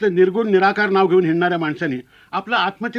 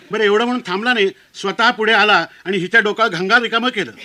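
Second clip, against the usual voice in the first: ordinary talk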